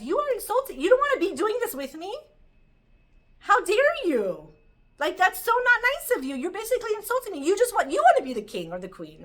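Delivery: loud, high-pitched offended sun; acted indignation. The sun takes the moon's words as an insult, indignant. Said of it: "the way the sun could perceive it".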